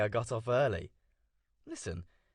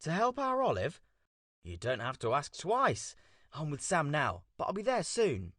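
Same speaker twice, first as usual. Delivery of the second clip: phone voice; 5 semitones lower, 5 dB quieter than usual. Real, not acted. Coop talking over phone call.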